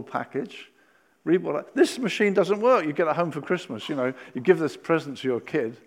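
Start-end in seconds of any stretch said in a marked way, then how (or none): none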